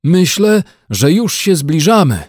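animated story-character cry; excited call. A narrator's reading. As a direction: call it out eagerly to the others. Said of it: "zawołał".

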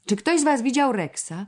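high, anxious "Czy ktoś z was widział Rexa?"; a worried girl asking about her lost dog; trembling voice. A narrator's reading. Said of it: "zapytała drżącym głosem". (drżącym, wysokim głosem)

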